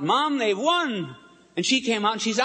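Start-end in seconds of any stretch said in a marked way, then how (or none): 0.0-1.2 mock shout, much higher and slow; retold shout. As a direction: call out the win to mother, excited. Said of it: "he shouted out".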